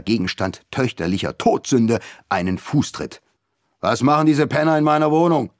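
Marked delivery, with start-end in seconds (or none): 3.8-5.5 angry colonel. A narrator's reading.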